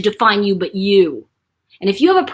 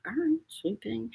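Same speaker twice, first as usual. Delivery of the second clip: very soft resigned mumble; grudging child; 13 dB quieter than usual. Retold or imitated. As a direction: give in quietly, imitating a sulky kid. Child self grudgingly obeys grandmother.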